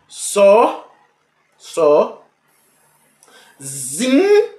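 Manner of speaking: Só, Só, Zinho, said as separate pieces with ZI pitched high; syllables sounded out. Shown as a demonstration. Teacher splitting sozinho by hand into its syllables. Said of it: "Vou usar as duas mãos".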